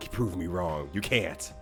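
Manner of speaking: ordinary delivery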